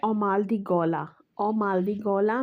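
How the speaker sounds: normal talking voice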